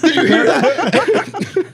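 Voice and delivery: very high, drawn-out amazed squeal through laughter; excited disbelief. Retold or imitated. Comic recalling an amazing moment, laughing high excited squeal.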